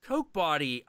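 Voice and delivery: ordinary talk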